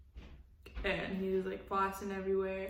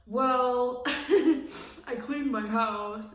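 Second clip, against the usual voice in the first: slow, laughing smug brag; mock proud, louder and chuckling; mocking dudes; 4 semitones higher, 7 dB louder, much slower than usual. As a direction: parody a guy expecting praise for nothing. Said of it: "when dudes do, like, the bare minimum".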